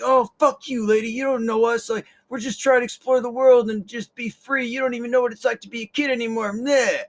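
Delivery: loud, high retort; defiant kids. Retold or imitated. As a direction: snap back at the lady. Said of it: "they're like".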